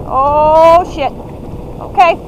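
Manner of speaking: ordinary talk, nothing marked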